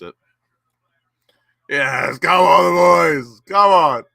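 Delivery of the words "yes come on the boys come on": real fan's shout of joy; cheering shout. Real, not acted. Fan cheering his team on.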